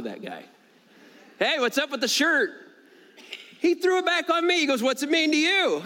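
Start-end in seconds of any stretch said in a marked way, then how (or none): 1.4-2.5 high, lively greeting, acted out; retold casual call-out. Preacher greets a man about his shirt, retold call-out. 4.8-5.8 much higher-pitched challenge; retold comeback question. Stranger turns the question on the preacher, retold comeback.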